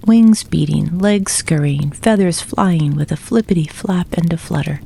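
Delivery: ordinary delivery, nothing marked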